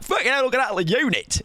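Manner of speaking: gawp at a big unit; mock-amazed stranger spotting a huge guy; impressed onlooker. Imagined onlooker gasping at him.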